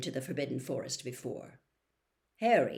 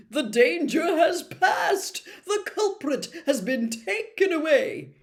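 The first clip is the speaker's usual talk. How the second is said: loud, higher, slow as if to dim pupils; slow and condescending; 10 semitones higher, 8 dB louder than usual. A narrator's reading. Lockhart lecturing the class as if they were dim, slow and loud. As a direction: spell it out for the slow ones. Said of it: "speaking slowly, as though they were all a bit dim".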